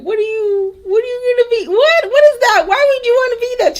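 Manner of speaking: high squeaky mocking kid voice; teasing child voice. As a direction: squeal it like a teasing little sister. Teasing sister voiced in story.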